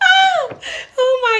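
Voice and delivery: high shrieking laugh. Real, not acted.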